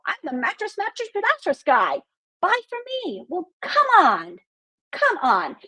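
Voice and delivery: high, eager mock sales pitch; pushy salesman. Mocking a pushy mattress guy, pitched high and eager. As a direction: ham up a desperate mattress seller. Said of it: "You went out there and said".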